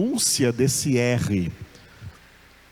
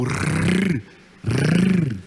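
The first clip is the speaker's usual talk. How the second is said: long rolled rrr sound; rolled r trill; 2 semitones higher than usual. Shown as a demonstration. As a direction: trill the r on its own. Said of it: "Esse rrr aqui acontece por causa da acentuação".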